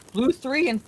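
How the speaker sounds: real accidental voice crack; voice breaking. Real, not acted. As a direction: let the voice crack unintentionally. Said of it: "sorry, my voice just broke there".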